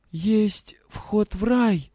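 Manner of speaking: put-on high, slow girl's voice; slow, strained voice. A narrator's reading. Girl after crying, talking to father, slow and drained. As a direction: begin slowly, as if cried out. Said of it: "начала медленно говорить девочка посаженным голосом".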